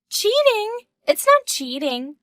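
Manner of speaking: high, incredulous echo of the word; indignant surprise. Acted. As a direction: throw the word back in disbelief. Mistress balks at the word.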